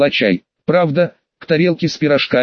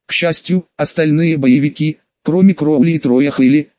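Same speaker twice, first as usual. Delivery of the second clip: plain reading voice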